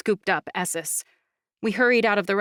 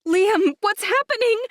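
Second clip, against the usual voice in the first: much higher, louder frightened shriek; panicked shriek; 14 semitones higher, 6 dB louder than usual. Acted. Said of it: "I shrieked, my voice rising several pitches".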